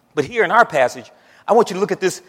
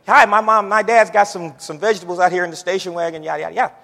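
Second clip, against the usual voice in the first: higher, brisk door-to-door patter; kid's sales pitch; 5 semitones higher, 5 dB louder than usual. Retold or imitated. Preacher as farm kid selling vegetables door to door, quick higher pitch. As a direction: rattle off a rehearsed doorstep pitch, tapering away. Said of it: "knocking on people's doors and we say".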